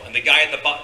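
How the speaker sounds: ordinary delivery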